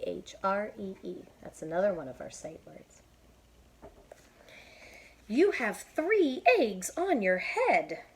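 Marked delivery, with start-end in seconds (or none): 5.3-8.0 high, loud, slow reveal; higher, louder, slow; Piggy announcing. Acted. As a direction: tell a friend the surprising news. Piggy tells Elephant news.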